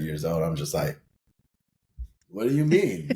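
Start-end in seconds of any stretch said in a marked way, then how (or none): 2.3-3.1 higher-pitched, baffled comeback. Retold or imitated. Retelling a baffled reply to a partner's question, baffled. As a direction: throw the question back, baffled.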